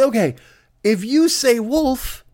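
high and slow, word by word; exasperated explaining. Acted. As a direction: lay it out for someone dim. Family spells it out.

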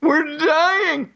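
high, whiny mock plea for help. Mocking the mayor's T-shirt plea, whiny and high. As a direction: ham up a desperate cry for help.

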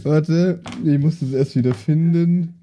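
ordinary talk, nothing marked

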